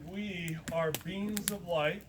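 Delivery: slow, spaced-out solemn statement; mind speak pronouncement. Retold or imitated. Zor revealing what they are, slow pronouncement. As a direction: announce it slowly with a long pause between sentences. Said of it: "in the mind speak".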